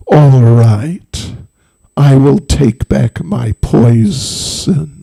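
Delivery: slow, drawn-out snake pronouncement. Acted. Snake agreeing to take back its poison, grave. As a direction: grant it slowly, in a sinister snake voice. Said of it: "And the snake said".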